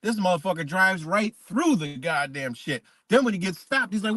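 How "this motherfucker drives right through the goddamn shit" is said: usual speaking voice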